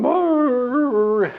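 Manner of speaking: high whining dog growl, mimicked; hound dog whine. Storyteller mimics the lazy hound dog, high whining growl. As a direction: imitate the hound dog's whimpering groan. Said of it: "a dog laying down going".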